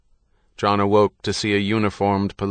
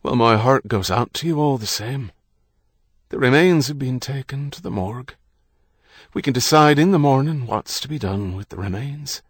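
higher, louder priest voice; Irish priest, brisk sympathy; 5 semitones higher, 5 dB louder than usual. A narrator's reading. Priest talking of the morgue to a widower, brisk sympathy. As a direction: offer condolence, then move to practicalities. Priest offers condolence and practicalities.

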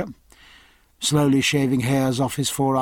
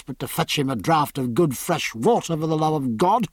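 ordinary delivery, nothing marked

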